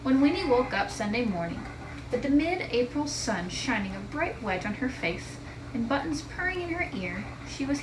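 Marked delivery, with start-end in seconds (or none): none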